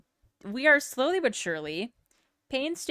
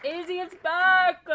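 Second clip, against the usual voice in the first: excited; 9 semitones higher, 5 dB louder than usual. Real, not acted. Excited greeting returning viewer.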